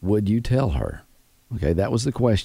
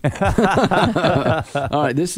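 laughing; 6 semitones higher, much slower than usual. Real, not acted. Host laughs at a silly topic.

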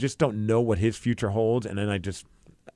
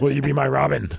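a hint of a superhero voice; lightly voiced amateur superhero impression; Batman. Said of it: "I'll call him as Batman".

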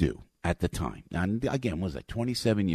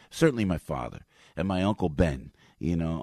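normal talking voice

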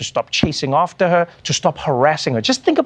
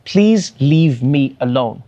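drawn-out slow plea, lightly acted; a woman slowly asking her ex to leave her alone; slow pleading; 3 semitones lower, much slower than usual. Slow, mild pleading. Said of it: "a woman saying to her ex".

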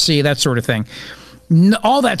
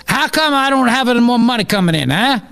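higher-pitched hammed-up Italian politician; mock Italian accent; 7 semitones higher than usual. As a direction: complain in a comic Italian accent. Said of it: "the Italian prime minister".

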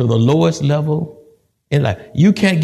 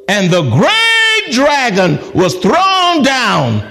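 dramatic scripture reading; 6 semitones higher, slower than usual. Acted.

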